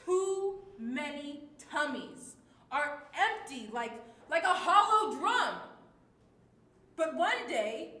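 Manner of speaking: loud, forceful angry reading. Shown as a demonstration. Teacher demonstrates reading the stanza angry, loud. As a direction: hurl the lines out in anger. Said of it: "in a tone that is angry".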